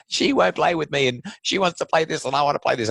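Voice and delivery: put-on high, loud complaining child; whiny kid voice. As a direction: whine like a tattling kid. Kids complaining, voiced by parent.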